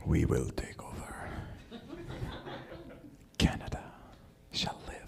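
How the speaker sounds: very soft, unvoiced-sounding plotting whisper; sinister whisper. Newcomers plotting to take over Canada, whispering. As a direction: whisper it like a secret takeover plan.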